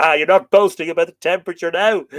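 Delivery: loud, real laughter through the words; laughing tease. Real, not acted. Host teasing a viewer about the cold, laughing loudly.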